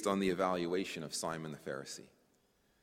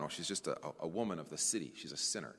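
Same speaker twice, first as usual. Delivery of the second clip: lower, softer mock dismissal; voicing an understated brush-off; mildly dismissive; 5 semitones lower, 6 dB quieter, faster than usual. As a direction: toss it off quickly and quietly, discounting her. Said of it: "discounted by Simon".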